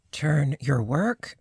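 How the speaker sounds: ordinary talk, nothing marked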